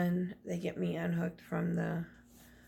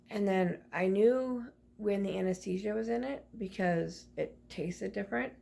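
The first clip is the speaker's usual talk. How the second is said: normal talking voice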